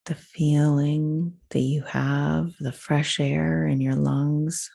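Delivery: unhurried visualisation in spaced-out phrases; guided meditation. Acted. Leads woods visualisation.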